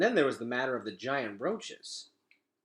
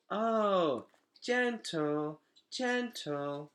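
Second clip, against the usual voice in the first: lightly voiced toddler, higher on the oh; a little kid repeating a word sweetly; toddler copying; 7 semitones higher, much slower than usual. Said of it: "said Boots".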